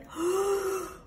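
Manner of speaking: big audible intake of breath; deep gasp. Shown as a demonstration. Reader showing young listeners a deep breath with a gasp. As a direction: gulp in a huge breath. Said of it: "Everybody take a deep breath".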